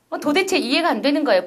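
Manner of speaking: normal talking voice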